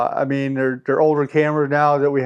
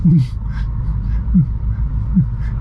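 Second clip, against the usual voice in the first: breathy laugh. Real, not acted. Laughing at Poupon joke.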